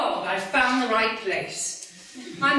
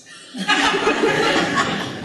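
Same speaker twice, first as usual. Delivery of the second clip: affected laugh; 12 semitones higher, 4 dB louder than usual. Acted. Opera singer laughs at herself.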